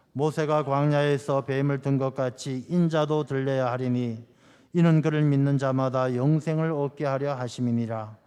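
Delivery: measured reading of a Bible verse; scripture reading. Acted. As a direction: read the verse steadily to the congregation. Congregation reads verse together.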